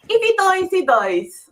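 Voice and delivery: lightly done Russian accent, flat delivery; mock Drago line in a Russian accent. Shown as a demonstration. Host repeats the line in a mild Russian accent. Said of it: "my Russian accent, very good".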